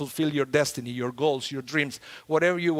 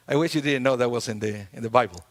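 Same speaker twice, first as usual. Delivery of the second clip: usual speaking voice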